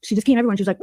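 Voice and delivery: ordinary delivery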